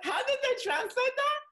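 very high, laughing question. Real, not acted. Cracking up at the podcast host's translation, laughing. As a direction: squeal it through laughter.

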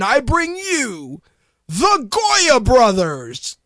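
milk the build-up to the team name; mock ring announcer. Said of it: "I'm gonna have a little fun with this".

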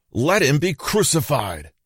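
high, strained crowd demand; storyteller's held-back shout; narrated mob shout. A narrator's reading.

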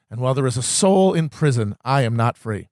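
ordinary delivery

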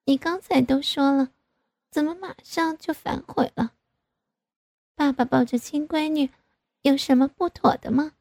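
wheedling. A narrator's reading. Grown daughter arguing with her father, wheedling. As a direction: complain with a pout. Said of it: "我撒娇地摇着爸爸的胳膊".

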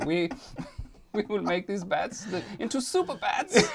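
high, laughing German mad-scientist voice; evil German accent. Acted. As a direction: plot world domination in a hammy German accent. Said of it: "that is the accent of evil".

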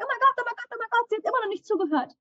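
high, fast, frantic voice of a fretting inner voice; high-pitched mock panic, rattling the words out fast; panicked anxious cry. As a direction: voice the jittery Anxiety character spiralling in panic. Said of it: "Und dann schreit er".

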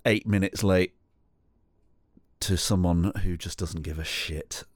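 usual speaking voice

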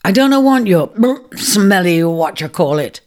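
words pushed out on a belch. A narrator's reading. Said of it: "he belched".

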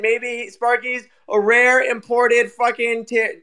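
ordinary talk, nothing marked

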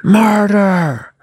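high, long-held holler; drawn-out shouting, pitched high; repeated yell. Retold or imitated. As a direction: imitate a slow alarm cry carrying across a valley. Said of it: "he yells it again over and over".

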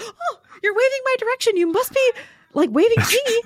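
high, giggly self-imitation; laughing mock self. Retelling her mix-up, she mimics herself laughing and high. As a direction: laugh through it, embarrassed and high. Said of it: "I'm like".

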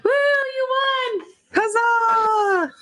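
excited cheer. Real, not acted. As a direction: cheer for the winner. Cheering the contest winner.

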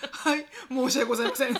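fast, laughing apology; apologetic phone voice. Retold or imitated. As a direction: blurt out the apology quickly. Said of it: "順番が違う".

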